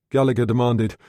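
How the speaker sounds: ordinary delivery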